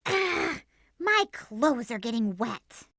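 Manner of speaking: ordinary delivery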